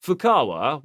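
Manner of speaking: ordinary delivery, nothing marked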